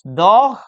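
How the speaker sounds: "dog" ending in a Spanish J, like "doh" with friction; loud wrong "dog" with an h-like fricative for G; wrong pronunciation with J. Shown as a demonstration. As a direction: scrape the end of "dog" like a Spanish jota. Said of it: "con J".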